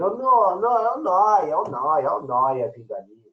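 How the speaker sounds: mock someone turning Noah into Noia; imitating people who garble the name Noah; mocking mispronunciation. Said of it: "dependendo do indivíduo que não sabe falar direito o nome dele".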